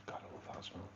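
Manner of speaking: whisper. Real, not acted. Sniffing something, whispered aside.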